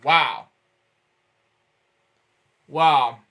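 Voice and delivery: ordinary delivery, nothing marked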